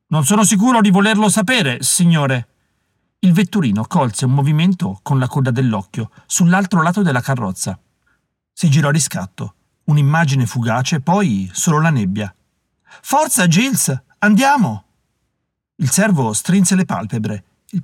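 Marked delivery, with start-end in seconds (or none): 0.1-2.5 lightly acted, higher-pitched worried servant; a touch of nerves, higher voice; uneasy coachman. A narrator's reading. Uneasy coachman answers his master. 13.0-14.8 high, slower call to the driver; impatient master calling out; urging passenger. A narrator's reading. Passenger urges the coachman on.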